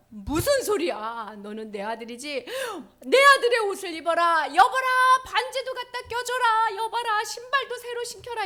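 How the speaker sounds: loud, high, joyful orders; father ordering a feast for his son, booming; commanding father. Acted. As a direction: command the servants proudly.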